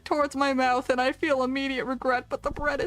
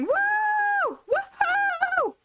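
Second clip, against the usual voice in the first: much higher, delighted squeal; cheering scream; much slower than usual. Retold or imitated. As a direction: cheer like your pet just did a trick. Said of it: "I'm screaming and going".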